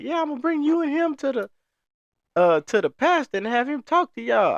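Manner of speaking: churchgoing lady telling off the speaker, mock high old lady voice; old church lady.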